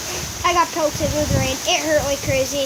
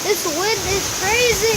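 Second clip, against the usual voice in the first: raised voice against the storm; shouting over wind; 6 semitones higher, 5 dB louder than usual. Real, not acted. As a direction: call out over the gusts. Vlogging outside in hurricane wind.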